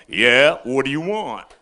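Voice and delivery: gruff stranger. Retold or imitated. Guy with shotgun voiced.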